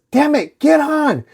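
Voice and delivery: high, loud urgent yell; desperate shout. Acted. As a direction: scream at her to get on now. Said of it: "Jake yells".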